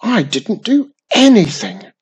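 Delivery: loud high whining protest; screaming. A narrator's reading. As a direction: yell it back in outrage. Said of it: "Slimy screamed".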